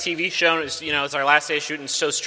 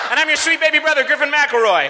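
louder, higher host shout; shouted intro; 9 semitones higher, 4 dB louder, faster than usual. Acted. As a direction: announce your name big.

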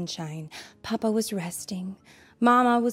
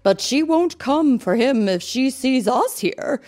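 loud, high-lifted mama bear voice; hammed-up, ringing Mama bear; 5 semitones higher, 8 dB louder than usual. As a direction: instruct the cubs as a big-voiced mother. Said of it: "said Mama".

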